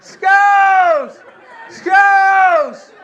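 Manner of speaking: very high, loud mock scream; screaming Skittles. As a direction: howl the word like the ward patient. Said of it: "he would just scream".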